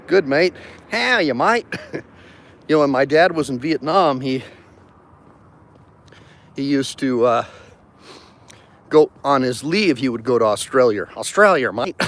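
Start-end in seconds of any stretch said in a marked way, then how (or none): 0.1-1.7 playful mock Aussie greeting, pitched up; mock Australian. Lawyer greets an Australian viewer, mock Aussie accent. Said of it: "You're Australian, huh?". 11.2-12.0 Aussie "Australia, mate" with a falling Australia; mock Australian. Vlogger mimics Australians saying their country, mock accent. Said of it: "funny you guys say Australia".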